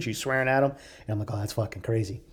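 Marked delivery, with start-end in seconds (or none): 1.3-2.2 low, soft non-committal reply; polite disinterest. Retold or imitated. Humoring a stranger's crazy story, politely uninterested. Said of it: "you really don't wanna talk to them".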